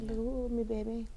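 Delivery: soft teasing come-on; sultry voice. Pretend. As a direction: purr it playfully. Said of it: "repeats catchphrase again".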